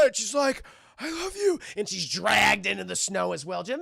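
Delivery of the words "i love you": high, strained farewell; hammed-up last breath declaration; mock dying words. Speared woman's dying words.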